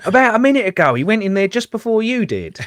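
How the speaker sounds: a helpful neighbour pitching up to tell; neighbour again. Retold or imitated. Neighbour quoted in a story.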